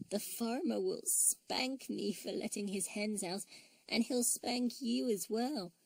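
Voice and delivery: a small bear crying about a spanking; mild tearful whimper; weeping. A narrator's reading. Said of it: "wept Tessie".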